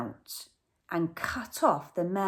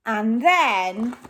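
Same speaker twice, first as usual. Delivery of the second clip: plain reading voice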